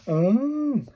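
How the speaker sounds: sudden high oh of discovery; a bright, surprised oh; realizing out loud. Real, not acted. Reacting to reading the kiya example.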